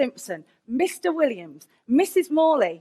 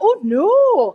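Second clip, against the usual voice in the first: higher, louder mock-Scottish refusal; Scottish accent; 5 semitones higher, 6 dB louder than usual. Scottish college staff turning down a phone applicant, Scottish accent. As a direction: put on a Scottish accent, pitched up. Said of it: "'cause they were Scottish".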